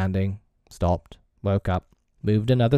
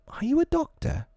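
put-on polite boy. A narrator's reading. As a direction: ask politely in a small high voice. Peter politely asks pretend doctor.

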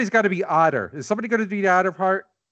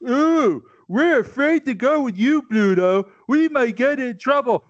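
mocking the cowards, higher; sneering mock fear; mocking whiny voice; 6 semitones higher than usual. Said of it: "famous speech".

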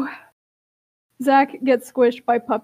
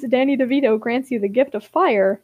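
normal talking voice

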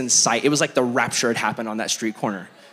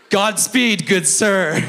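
mock courtly; 6 semitones higher, 4 dB louder, much slower than usual. Mock polite blessing to driver.

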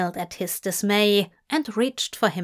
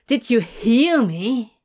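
louder, insistent question; demanding; 5 dB louder than usual. Acted. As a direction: press him for an answer, loud. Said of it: "Crowley demanded".

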